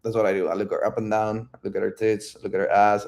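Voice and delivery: ordinary talk, nothing marked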